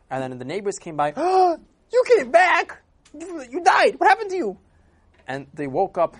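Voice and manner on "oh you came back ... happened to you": very high, loud shocked cry; astonished shout. Acted. Neighbors shrieking at the man back from the dead, very high and loud.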